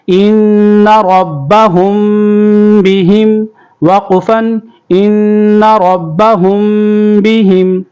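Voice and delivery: ان ربہم بہم with the nasal ghunna held on the nun and the mim; slow tajweed reading, nasal hum on each mim; ghunna on both. Shown as a demonstration. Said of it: "ان پہ بھی غنہ کریں گے".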